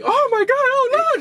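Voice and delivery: very high-pitched mock wail of alarm; imitated loud panic. Room full of people after the girl fainted, imitated loud panic. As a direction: shriek it like a panicking crowd, hammed up. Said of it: "just, like, loud people".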